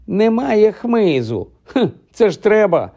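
high, drawn-out mock whine; mocking mimicry. Ron teasing Hermione about the firewood, mocking.

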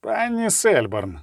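slow venomous drawl, stretching the name. A narrator's reading. Pugach taunting Kenna with a slow venomous drawl. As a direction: drag out the name with poison. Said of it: "сказав протягло і ядуче Пугач".

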